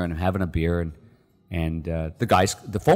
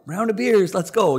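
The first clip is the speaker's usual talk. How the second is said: much higher, louder buddy cheer; celebrating cheer; 12 semitones higher, 4 dB louder than usual. Retold or imitated. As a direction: toast like the rowdy teammates. Teammates celebrating.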